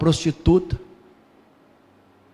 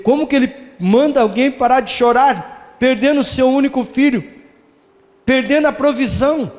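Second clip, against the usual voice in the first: higher, incredulous questioning; doubting objector; 7 semitones higher than usual. Retold or imitated. Preacher voices a doubter questioning Jesus, incredulous and higher. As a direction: voice the skeptic who can't believe it. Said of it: "Alguém poderia dizer".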